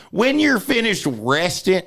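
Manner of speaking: high, drawn-out grandma nagging; grandma's voice. Retold or imitated. Grandma nagging seated grandson, high drawn-out imitation. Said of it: "That's how she talked".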